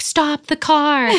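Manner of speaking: high, slow, urgent cry to pull over; urgent excited call. Retold or imitated. At the sheep field, telling the driver to stop: excited high call. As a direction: blurt it out like you just spotted something amazing. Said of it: "and I was like".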